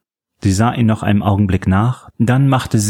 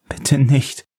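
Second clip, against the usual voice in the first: terrified plea; 6 semitones higher than usual. Acted. Catherine begging in terror.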